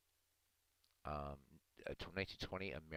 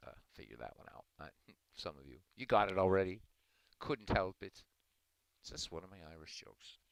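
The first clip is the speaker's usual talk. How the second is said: ordinary talk, nothing marked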